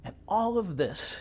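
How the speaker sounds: slow, measured poem recital with weighted phrases; deliberate, emphatic poet reading aloud; poetry reading. Acted. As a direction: declaim it line by line, pausing between images. Reads own poem aloud.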